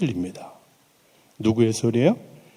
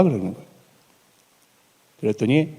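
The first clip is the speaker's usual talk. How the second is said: ordinary talk, nothing marked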